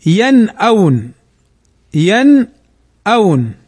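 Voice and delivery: slow ينأون, "yan-awn", clear n before the hamza; clear izhar. Shown as a demonstration. Teacher quoting the verse, slow clear izhar on ينأون. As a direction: draw out ينأون with a distinct unmerged n. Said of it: "نظهرها ولا ندغمها".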